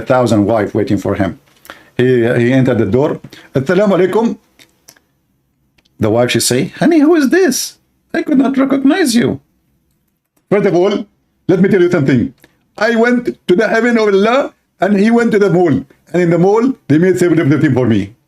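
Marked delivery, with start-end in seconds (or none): 3.5-4.4 lightly raised voice of a husband arriving; mock husband greeting at the door; husband's greeting. Husband voiced entering bedroom. 6.7-9.4 much higher squeaky wife voice, startled; high mock wife in disbelief; shocked wife. 10.5-18.1 higher put-on husband voice, showing off; mock husband bragging to wife; boasting husband.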